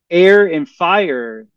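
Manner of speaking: ordinary talk, nothing marked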